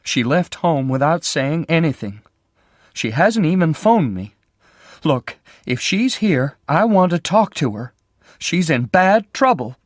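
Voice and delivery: a frightened sister pleading, voice starting to shake; voice beginning to shake. A narrator's reading. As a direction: plead with a voice about to break. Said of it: "her voice beginning to shake".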